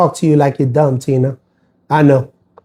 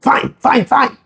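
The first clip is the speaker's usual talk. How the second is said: grudging fine; 10 semitones higher, slower than usual. Retold or imitated. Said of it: "pissed off".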